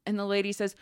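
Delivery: normal talking voice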